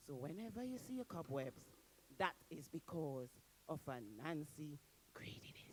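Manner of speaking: soft whisper, a little quick; whispered moral. A narrator's reading. Storyteller whispers the moral to listeners, hushed. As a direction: confide the lesson in a whisper.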